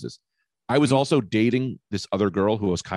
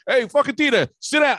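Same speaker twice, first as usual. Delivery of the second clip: much higher, louder loud-Italian-dad voice; imitating dad; 13 semitones higher, 3 dB louder than usual. Dad greeting son's wife, loud high mock-dad call. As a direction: bark a hearty welcome like a loud father. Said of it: "he goes".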